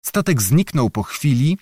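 ordinary delivery, nothing marked

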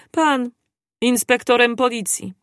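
high disbelieving question; shocked, voice jumps up; astonished. A narrator's reading. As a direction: Pan pitched high in disbelief. Servant stunned by revelation.

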